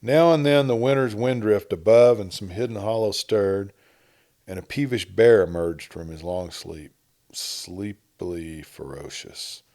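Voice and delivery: usual speaking voice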